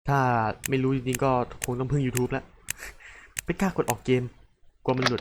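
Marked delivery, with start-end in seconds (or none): none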